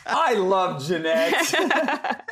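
laughing through the words, high and drawn out; slow, high, giggling delight. Retold or imitated. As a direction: blurt it out mid-laugh, delighted. Friend's delighted reply, laughing.

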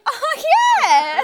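loud, high, laughing "oh yeah"; suddenly remembering, laughing; sudden realization. Retold or imitated. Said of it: "I was like".

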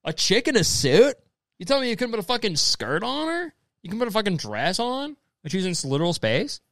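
high, incredulous mock protest; acted outraged disbelief. Man reacting to a picture of a woman in a spacesuit, mock outrage. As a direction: act baffled, pitch swooping up. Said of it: "being like".

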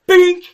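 sudden high, loud impact noise; imitated bonk. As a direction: pop it out like a head hitting the raft. Sound of hitting the raft underwater.

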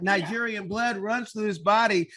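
loud, high-pitched hype for the guest; emphatic encouragement. Real, not acted. Host hypes guest to talk freely.

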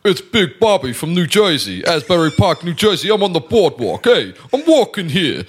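brash New Jersey boardwalk guy calling out his tip; playful New Jersey accent to his co-hosts. Pretend. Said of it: "can I do my New Jersey accent".

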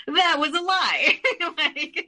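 a real burst of laughter at an absurd lie; laughing. Real, not acted. Laughing about her mother's fake meetings, laughing high.

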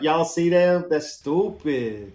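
put-on Chicano accent with a Texas twang, "That's stupid." stretched slow. Host imitating his college roommate's Texas Chicano accent. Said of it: "Chicano accent mixed with a little bit of a Texas twang".

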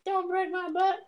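very high falsetto begging; mock victim plea. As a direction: squeal it as a pretend plea. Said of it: "would I start screaming".

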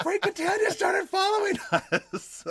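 high laughing squeal; excited scream. Retold or imitated. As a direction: squeal it with glee. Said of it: "I screamed".

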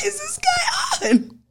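slow, high, cracking up with laughter; laughing disbelief. Real, not acted. Cohost laughing at his wild plan, slow and high. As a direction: stretch it out, helpless laughter.

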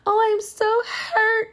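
high, slow mock-wounded whine; exaggerated fake heartbreak, mocking it; mock hurt. Mocking the idea she is heartbroken, high slow whine. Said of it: "it doesn't irritate me like".

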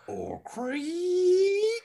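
high squeaky creak; creaking door sound. Acted. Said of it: "you did your own sound effects".

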